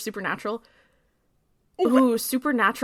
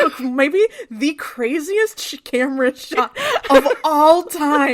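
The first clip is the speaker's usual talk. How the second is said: laughing slowly through the words; cracking up while talking; 3 semitones higher, 5 dB louder, slower than usual. Real, not acted. Laughing while ranting to her cohost.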